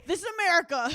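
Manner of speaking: mock indignant. Mocking the DMV lady's rules.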